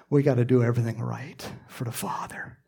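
unvoiced, reassuring whisper; soft whispered reassurance to John; gentle whisper. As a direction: whisper it kindly, settling him. Jesus answers John gently.

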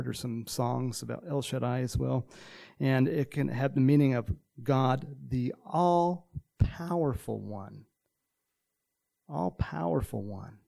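usual speaking voice

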